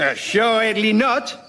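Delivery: put-on slow, drawn-out French accent; outrageous French accent. Voltaire denying it in a slow French accent. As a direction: savour each syllable like a mock French aristocrat. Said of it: "in outrageous French accent".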